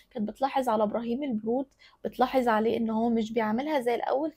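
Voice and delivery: normal talking voice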